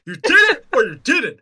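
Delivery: put-on badgering brother voice; brother impression. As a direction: press for an answer as the needling brother. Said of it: "That's my brother impression".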